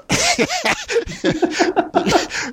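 high, helpless burst of laughter; laughing. Real, not acted. Host tickled that listeners learn English from him, laughing. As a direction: crack up mid-sentence in a long high laugh.

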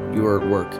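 ordinary talk, nothing marked

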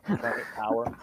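low mock voice. Echoing a silly chant.

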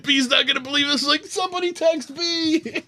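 high, giddy urging; acted giddy excitement. Fan urging friends to tell absent buddy, acted giddy excitement. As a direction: urge them on in an excited high squeal.

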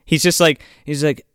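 ordinary talk, nothing marked

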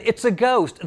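high, loud cry of alarm; frightened cry. A narrator's reading. Disciples seeing a figure on the lake, frightened cry. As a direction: call it out in understated fright. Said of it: "they screamed".

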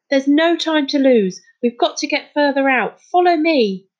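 high, loud urgent command; exasperated, pressing call to follow; frustrated. A narrator's reading. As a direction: urge them on with frustration. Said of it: "in frustration".

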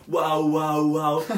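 loud repeated mock wails; sustained, loud mimicked yelling; imitated screaming. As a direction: mimic someone screaming nonstop. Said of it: "A gaja fez isto durante aí dez vezes".

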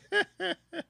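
short high chuckle; laughter. Real, not acted. Host amused by guest's kinda difficult answer, laughing.

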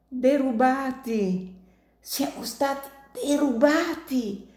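high, slow moaning lament. Acted. Dying miner moaning to Martino about the theft. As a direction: groan it out in pain. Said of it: "gemette il disgraziato".

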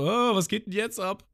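high mock-panicked Lysop voice; Lysop imitated. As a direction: play Lysop, suddenly alarmed. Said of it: "Und Lysop so". (hohe, gespielt panische Stimme)

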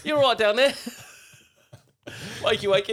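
chirpy Cockney waking a mate up; mock Cockney accent. Friend mocking a Moon Knight accent: shrill Cockney wake-up call. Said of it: "that fucking Moon Knight accent".

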